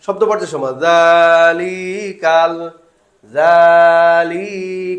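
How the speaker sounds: sing-song জালিকাল with every syllable stretched long; mock the pupils who stretch জালিকাল all through; wrong drawn-out reading. Shown as a demonstration. Teacher imitates pupils wrongly stretching জালিকাল. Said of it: "সবগুলো টান হয়ে গেল".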